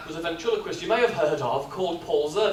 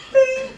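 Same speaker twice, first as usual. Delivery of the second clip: high, loud sung "bing" like a lift chime; lift bell; 8 dB louder than usual. Retold or imitated. Imitating the lift chime for the audience. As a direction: ring it out like the lift arriving. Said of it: "and it goes bing!".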